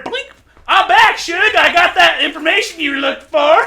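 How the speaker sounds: high, loud, gleeful character voice; excited returning helper. Acted. Helper back with information for Dolly, excited shout. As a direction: burst back in loudly with the news, pitched high.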